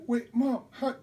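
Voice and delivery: high, loud breathless wonder; amazed excitement. Retold or imitated. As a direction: stammer in delighted disbelief. Child seeing presents.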